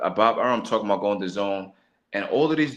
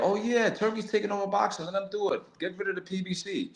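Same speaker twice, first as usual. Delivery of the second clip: higher, sarcastic mock quote; mocking fans; 7 semitones higher, 5 dB quieter than usual. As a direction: parody the fans with a sneer. Mocking what boxing fans say.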